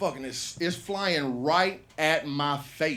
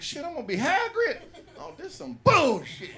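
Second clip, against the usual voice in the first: high, loud words breaking into laughter; cracking up at his own joke, louder and higher; laughing high outburst; 11 semitones higher, 5 dB louder than usual. Real, not acted. Telling listeners he'll be stuck as Hagrid, laughing loudly. Imagining being made Hagrid.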